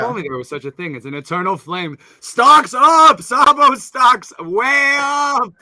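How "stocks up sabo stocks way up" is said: high, loud, drawn-out hype cry; hyped shout. Acted. Hyping friend over Sabo's comeback, high loud shout. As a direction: yell it like a stock ticker exploding.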